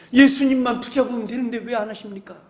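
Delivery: ordinary talk, nothing marked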